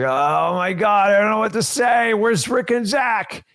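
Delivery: high, slow mock panic; panicked newbie. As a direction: play the lost rookie begging for help. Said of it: "most of you are like".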